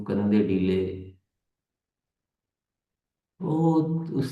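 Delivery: settled, unhurried instructions for going inward; guided meditation. Real, not acted. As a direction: ease listeners gently into stillness, leaving long silences.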